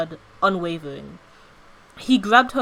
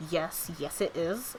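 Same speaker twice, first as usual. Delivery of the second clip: quiet annoyed thought; 3 semitones lower, 11 dB quieter, slower than usual. A narrator's reading. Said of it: "slight annoyance brewing".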